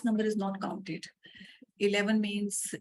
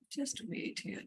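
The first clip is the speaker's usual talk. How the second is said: whispered aside; 7 semitones higher, 8 dB quieter, slower than usual. Real, not acted. Muttering while typing.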